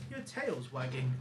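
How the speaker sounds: ordinary talk, nothing marked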